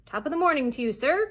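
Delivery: loud, high, cheery greeting; crying out. A narrator's reading. Silver welcoming the doctor, crying out. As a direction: call out a big welcome. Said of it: "cried Silver".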